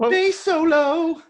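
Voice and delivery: loud, high, drawn-out call; mock announcement. Joking about a bass solo, loud drawn-out cry. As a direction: herald it like a rock show.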